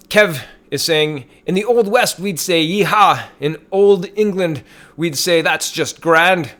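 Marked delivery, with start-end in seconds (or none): 1.5-3.2 put-on Old West. Mock Old West catchphrase read out. 3.4-6.5 high, slow mock-English voice; hammy, Scottish-tinged English accent; terrible English accent. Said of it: "That's a terrible English accent".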